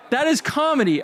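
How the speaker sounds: ordinary delivery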